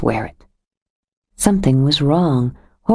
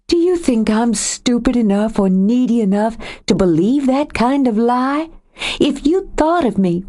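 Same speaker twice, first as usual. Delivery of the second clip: a higher woman's voice, rising with hurt; Joe, wounded and angry; 6 semitones higher than usual. A narrator's reading. Joe accusing Nathan of leaving, wounded and angry. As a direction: accuse him of leaving her.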